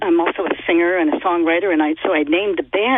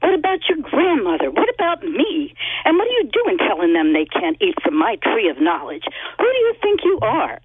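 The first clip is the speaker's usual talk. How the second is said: indignant tone. Acted. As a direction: play an affronted goddess telling off a god. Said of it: "So I'm putting a tone on it".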